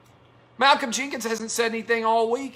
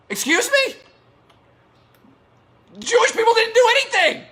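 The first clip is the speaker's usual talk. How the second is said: incredulous; 12 semitones higher, faster than usual. Real, not acted. Outraged at the anti-Semitic post.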